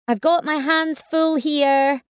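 slow, high exasperated snap; snapping. Acted. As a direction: snap back, patience gone. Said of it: "I snapped back".

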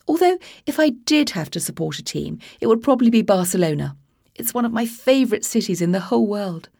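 posh prattler chattering to the skinhead; posh English accent, oblivious and gushing. Acted. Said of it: "sounded like he'd swallowed a silver spoon".

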